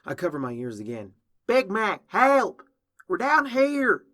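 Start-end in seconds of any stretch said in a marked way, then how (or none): 1.5-4.0 loud, high, urgent holler; yelling for help. A narrator's reading. Applejack trapped in a well calling Big Mac, yelling for help. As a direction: shout up to someone far above. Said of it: "Applejack keeps on yelling".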